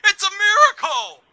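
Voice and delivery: high-pitched, loud mock yell; Bobby Heenan yelling. Retelling a wrestling moment to a co-host, imitated high yell. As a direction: cry it out far above your normal pitch. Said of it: "Bobby Heenan yelling".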